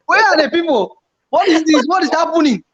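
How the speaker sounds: 2 high, panicked disbelief; 3 replay the shock, voice jumping up; 1 retold panic. 4 YouTuber reliving subscriber drop, high panic.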